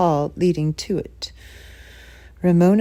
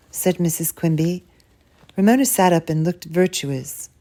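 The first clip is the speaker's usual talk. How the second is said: ordinary delivery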